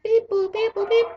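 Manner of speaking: very high squeaky beep boop; mock droid noises; robot beeps. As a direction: chirp like a little robot.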